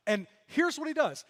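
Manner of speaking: ordinary talk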